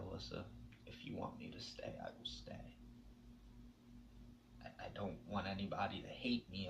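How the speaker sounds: soft, near-whispered vow to stay; hushed tender promise. Retold or imitated. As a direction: promise her quietly you will stay.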